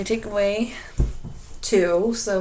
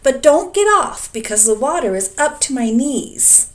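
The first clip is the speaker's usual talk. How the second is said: higher, louder dragon voice; dragon warning; 6 semitones higher, 7 dB louder than usual. A narrator's reading. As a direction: warn him to stay on. Dragon warns Elmer.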